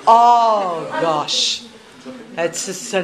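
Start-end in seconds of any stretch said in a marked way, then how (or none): none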